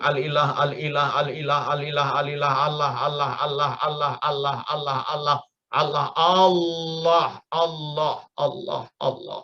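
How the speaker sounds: "al-ilah" repeated nonstop until it blends into "Allah"; joined up. Shown as a demonstration. Teacher shows "al-ilah" fusing into "Allah" through repetition. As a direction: chain "al-ilah" without breaks, melting into "Allah", one stretched long. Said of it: "kalau disambung".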